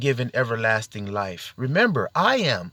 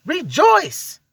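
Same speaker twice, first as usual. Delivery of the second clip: high exultant call; rejoicing; 13 semitones higher than usual. Real, not acted. Preacher calling listeners to rejoice, exultant and high. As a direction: lift it up in a joyful cry.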